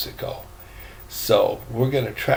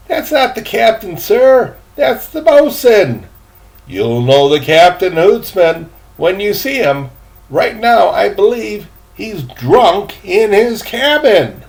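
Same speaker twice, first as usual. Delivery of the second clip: put-on high, louder boy's voice; eager boy; 8 semitones higher, 8 dB louder than usual. Boy telling the gentleman about the captain, high and eager. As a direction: play the cheerful young deckhand.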